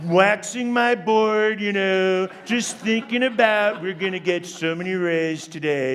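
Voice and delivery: laid-back Californian beach-dude voice; surfer dude. Acted. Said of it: "picture a surfer dude".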